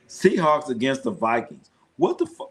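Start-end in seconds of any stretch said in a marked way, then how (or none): 2.0-2.5 cut-off curse jumping way up; high shocked outburst. Real, not acted. Vlogger baffled by the Vikings, high shocked outburst. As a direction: blurt it in sudden disbelief.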